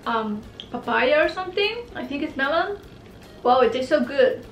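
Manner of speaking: chewing while talking; speaking around hard candy; mouth full of candy. Real, not acted. Seen 1: Holds yellow candy tube, visibly chewing while saying melon line.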